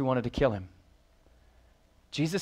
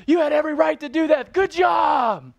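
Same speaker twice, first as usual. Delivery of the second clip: much higher, loud, fast shouted approval; mock cheering praise; 14 semitones higher, 8 dB louder than usual. The preacher mocks the praise Jesus never gave, high loud cheering. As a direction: cheer him on like a sarcastic coach. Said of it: "Notice he didn't say".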